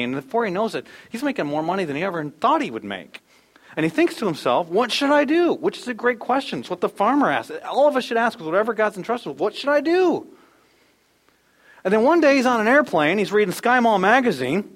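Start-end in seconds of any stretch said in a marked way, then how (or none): 4.7-5.5 wondering aloud. Acted. Rich man wonders to himself. 9.4-10.3 high, pleading question; searching question. Acted. A believer asking God what to do with his gifts, pleading high. Voicing the question all should ask.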